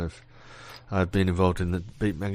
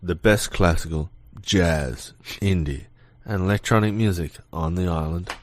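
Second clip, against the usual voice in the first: low-pitched station promo, genre by genre; deep radio voice; 3 semitones lower than usual. Pretend. Host plugging the island station's music, deep radio voice. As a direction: list the music like a sexy DJ. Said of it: "Your voice goes deeper".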